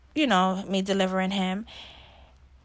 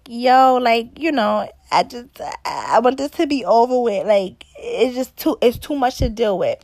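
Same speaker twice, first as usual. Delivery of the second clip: fed-up; 2 semitones higher, 7 dB louder than usual. Retold or imitated. Demanding nurses leave.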